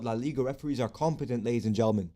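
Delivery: fast, rising to a loud cry; excited shout. Real, not acted. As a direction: proclaim it with relief. Relief as referee checks monitor.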